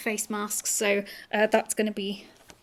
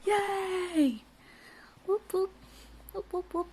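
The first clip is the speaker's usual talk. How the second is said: little cheer; 9 semitones higher, 7 dB quieter, slower than usual. Real, not acted. Celebrating the finished bag.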